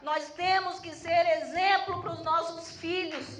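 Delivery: loud, emphatic sermon delivery; fervent testimony from the pulpit; forceful preaching. Real, not acted. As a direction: drive each point home to the congregation.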